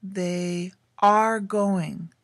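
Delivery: they are going said very slowly, as a teacher highlights the verb; slow emphasis. Shown as a demonstration. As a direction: slowly stretch out they are going, each word weighted. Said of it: "In the second sentence".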